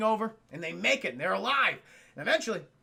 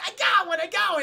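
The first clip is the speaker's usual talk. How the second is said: excited shout; 11 semitones higher, 6 dB louder than usual. Acted. Luke excitedly shouting in turret.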